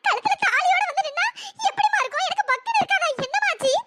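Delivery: put-on high, fretful old woman's voice; anxious grandmother. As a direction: press her gently but urgently like a worried granny. Grandmother pleads to know.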